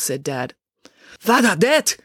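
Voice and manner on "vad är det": high startled cry in Swedish; alarmed cry. A narrator's reading. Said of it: "cried Ingrid".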